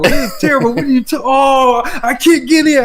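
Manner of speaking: high, exasperated mock rant; imitating pissed-off friend. Imitating Mo failing to get a pre-order, pissed off. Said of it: "Mo was just so pissed".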